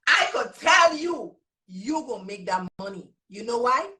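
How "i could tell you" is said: slow, louder Virgin Islands accent. Real, not acted. Teacher repeating her promise to the group, emphatic Virgin Islands accent. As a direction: insist on it in a drawn-out VI accent. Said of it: "that means I can tell you".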